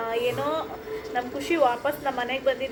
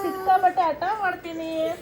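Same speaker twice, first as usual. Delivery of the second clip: higher, mock whining child voice; voicing whiny son; 5 semitones higher than usual. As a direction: whine it like the stubborn little boy.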